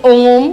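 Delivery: ओम with the O stretched out; drawn-out demo of ओम; prolonged vowel in ओम. Shown as a demonstration. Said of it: "अधिक समय लगता है उन्हें प्लुत".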